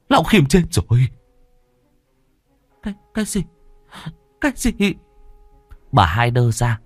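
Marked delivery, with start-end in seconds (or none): 0.1-1.1 higher, louder alarm; frantic cry. Acted. Announcing the death. 2.8-4.7 much higher, stunned squeak; shocked disbelief. Acted. Shocked wife hears of death.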